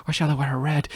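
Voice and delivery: ordinary delivery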